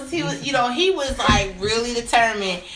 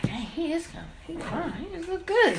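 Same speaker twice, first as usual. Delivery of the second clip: soft, breathy-free hushed admiration under the breath; quiet retold admiration; hushed admiring aside; 7 semitones higher, 9 dB quieter than usual. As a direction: murmur it like you're checking him out.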